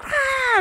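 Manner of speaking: short shrill startled scream, very high; girl screaming when the TV turns on; retold scream. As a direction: shriek at the jump scare. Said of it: "she, like, screams".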